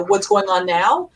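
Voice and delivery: ordinary talk, nothing marked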